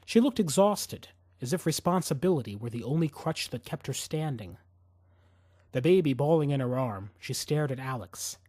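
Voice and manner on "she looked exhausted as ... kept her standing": usual speaking voice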